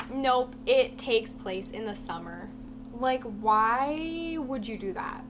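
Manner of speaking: ordinary talk, nothing marked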